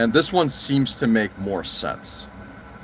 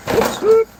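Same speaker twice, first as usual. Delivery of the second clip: sudden high yelp of surprise; startled, voice jumps way up; surprised whoops; 6 dB louder than usual. Real, not acted. Bumps something on the desk.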